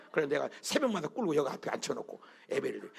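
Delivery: normal talking voice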